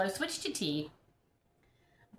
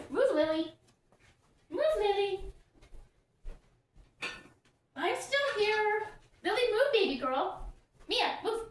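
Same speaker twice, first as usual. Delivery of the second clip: much higher sing-song coaxing to the dogs; 13 semitones higher than usual. Real, not acted. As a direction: coax the puppies out of the way. Talking to her dogs underfoot.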